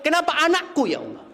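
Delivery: high, wailing complaint to God; imitating a bitter griever's wail; anguished lament. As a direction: cry out why me, pleading. Parent wails over lost child.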